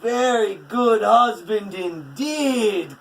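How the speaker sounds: loud, slow, satisfied; hearty cry. A narrator's reading. Odin pleased with the match, crying out. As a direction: proclaim it with relish. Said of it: "cries Odin".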